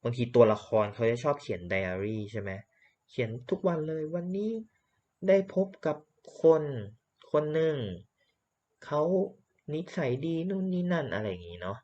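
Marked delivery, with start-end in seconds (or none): 3.1-11.8 diary writer. Acted. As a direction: voice the diary entry higher, dictating in little pieces.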